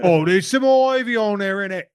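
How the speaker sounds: high, loud put-on London accent with innit; hammed-up cockney tourist; mock British accent. Joking about Chicago sights in a mock London accent. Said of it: "That's my Chicago accent".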